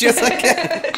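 high laughing comeback; laughing mock retort. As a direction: snap back while laughing. Mock song duet quarrel.

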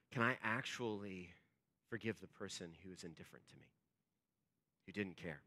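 low, very soft wondering; hushed inner question. Retold or imitated. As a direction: ask it as if privately to yourself. Voicing a painful inner question.

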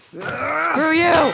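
loud, high jeer at an enemy; defiant yell. Real, not acted. Gamer to a stupid soldier, defiant yell. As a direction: yell it out, rising in pitch.

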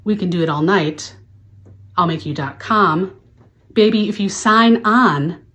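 normal talking voice

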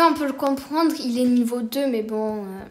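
ordinary delivery

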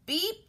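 short, high horn beep; little truck beep. A narrator's reading. Blue greets the toad, little horn beep. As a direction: chirp out a quick truck beep. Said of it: "said Blue".

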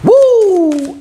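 high, sustained whoop; excited woo. Real, not acted. Reader reacting to the new card for Leo, whooping high and loud.

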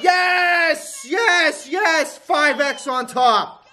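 high, shouted yes yes yes; thrilled jackpot yell; ecstatic yelling. Real, not acted. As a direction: scream it out in pure joy. Big win on gambling stream.

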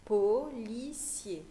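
Policier split into slow separate syllables. Shown as a demonstration. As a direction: sound out Policier very slowly for a young pupil.

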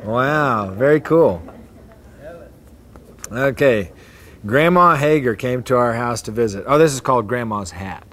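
ordinary delivery

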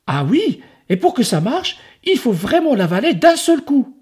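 put-on high, insistent kid; high boy voice. Enzo insisting the ogre swallow it all, high. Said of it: "répond Enzo".

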